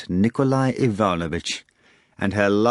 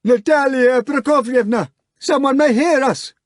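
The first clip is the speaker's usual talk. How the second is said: high loud begging hog; pleading bellow; 5 dB louder than usual. Acted. The hog begging Natasha, high and loud. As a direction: bellow it imploring. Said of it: "the hog bellowed pleadingly".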